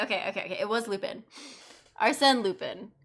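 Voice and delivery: ordinary talk, nothing marked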